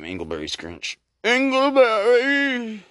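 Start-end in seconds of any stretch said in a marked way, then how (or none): 1.2-2.8 slow high drawn-out ghost call; ghostly wail. Acted. Ghost calling the miser by his first name, wailing. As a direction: moan the name like a haunting spirit.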